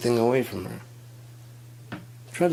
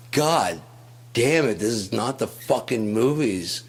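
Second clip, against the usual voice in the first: louder, higher exasperated shout; angry yell; 5 semitones higher, 4 dB louder than usual. Retold or imitated. Boyfriend yelling at girlfriend to stop, angry louder shout. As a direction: yell at her to stop the nonsense. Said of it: "I'm like".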